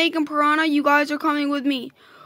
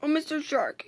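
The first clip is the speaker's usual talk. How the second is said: ordinary delivery, nothing marked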